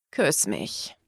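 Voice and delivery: deep, soft intimate order; low coaxing command. A narrator's reading. Man demanding a kiss in bed, low and coaxing.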